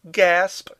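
high, loud theatrical cry; called out. A narrator's reading. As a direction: call it out to the next room. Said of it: "Furman called out from the dining room".

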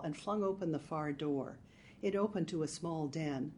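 ordinary delivery, nothing marked

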